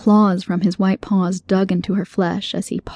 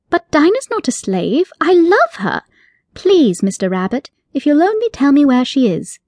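raised, earnest plea; girl protesting her love for her cat; pleading Alice; 6 semitones higher, 3 dB louder than usual. Acted. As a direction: beg him to say where her cat is.

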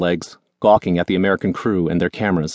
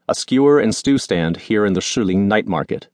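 ordinary delivery

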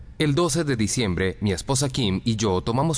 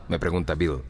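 ordinary delivery

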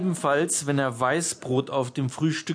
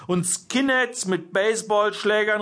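high, indignant exclamation; calling out; 6 semitones higher, 3 dB louder than usual. A narrator's reading. Jörg objecting at the parents' evening, calling out. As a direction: raise the voice in outrage. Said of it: "rief Jörg".

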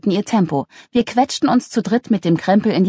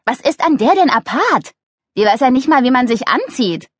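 higher, louder streetwalker's scorn; disdainful, raised-pitch retort; scornful Usch; 7 semitones higher, 3 dB louder than usual. Acted. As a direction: run down the rival indignantly. Voices Usch.

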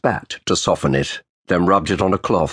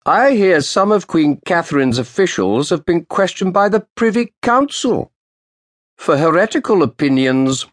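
put-on old official's sharp greeting; 10 semitones higher than usual. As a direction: snap out a cold greeting to the lawyer. Roland's voice.

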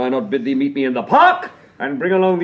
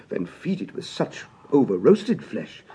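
ordinary talk, nothing marked

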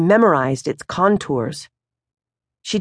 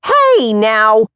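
louder, higher Hey now to break it up; firm interrupting mother; 6 semitones higher, 6 dB louder than usual. Acted. Mother stepping between father and son, firm. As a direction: step in between them. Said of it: "Moms says".

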